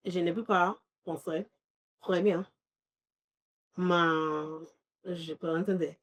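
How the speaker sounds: halting beginner's French; very basic French. Retold or imitated. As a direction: feel your way slowly through basic French. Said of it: "in my very basic French".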